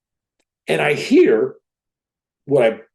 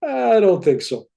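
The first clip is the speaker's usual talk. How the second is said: indignant protest; 9 semitones higher, 4 dB louder than usual. Retold or imitated. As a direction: push back in disbelief, pitched high. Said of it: "I s-, I said".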